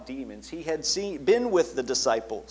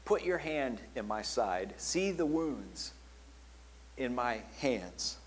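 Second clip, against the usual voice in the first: gentle Jesus; 8 dB quieter, slower than usual. Acted. Jesus speaking to Thomas.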